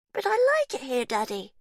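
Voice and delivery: put-on very high, eerie child's voice; as if someone speaks through her; creepy child telling her uncle she likes it here; chilling little girl. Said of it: "something chilling about the little girl's voice".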